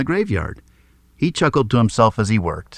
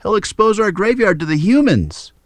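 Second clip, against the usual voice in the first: alarmed; 10 semitones higher, 3 dB louder than usual. A narrator's reading. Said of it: "cried Ula".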